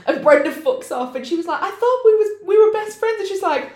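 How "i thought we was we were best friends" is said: high, plaintive mock protest; hurt appeal. [name] as Brenda abandons her, in a mock wounded appeal. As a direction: plead it high, hammed up.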